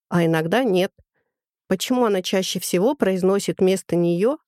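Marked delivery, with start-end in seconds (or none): none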